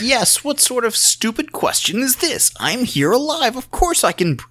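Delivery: high, exasperated mock outrage at a stupid question; imitating a performer mocking a reporter; indignant scoff. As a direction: scoff as if the question insults you. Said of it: "He's like".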